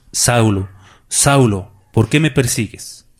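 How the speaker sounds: loud, solemn call with falling endings; voice from heaven. Acted. The Lord calls Saulo on the road, solemn loud call. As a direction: call out firmly, each name set apart. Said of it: "oyó una voz que decía".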